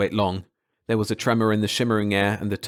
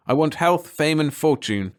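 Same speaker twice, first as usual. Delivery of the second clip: stubborn; 6 semitones higher than usual. Acted. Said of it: "Kelvin said stubbornly".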